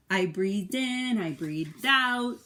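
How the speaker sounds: slow, soothing in and out; slow calm breathing words. A narrator's reading. Parent reading to a child, slow and soothing.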